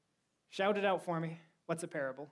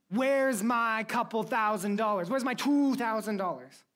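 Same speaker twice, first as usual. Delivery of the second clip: angry demand; 5 semitones higher, 6 dB louder than usual. Acted. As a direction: shake him down for the cash, loudly. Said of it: "he demanded the payment instantly".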